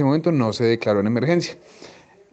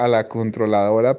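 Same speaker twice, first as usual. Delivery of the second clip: usual speaking voice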